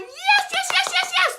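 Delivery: high loud repeated yeses; cheering God voice. Pretend. God cheering your next step, high loud yeses. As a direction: shriek the yeses like a fan. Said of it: "he's literally going".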